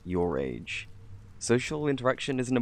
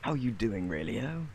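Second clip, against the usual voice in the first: normal talking voice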